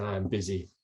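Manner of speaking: put-on low, soft German-accented voice; German coach impression, deep and quiet. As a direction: imitate the German coach, pitched well down. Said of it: "there's a deep German accent".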